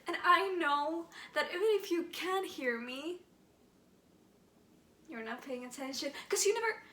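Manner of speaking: aching, bitter questions to a silent father; wounded and reproachful; hurt pleading. Acted. Monologue to unconscious father.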